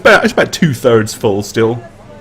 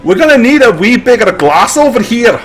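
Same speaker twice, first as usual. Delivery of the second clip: amused Scottish patron; 10 semitones higher than usual. Acted. Patron mocks the tiny cup.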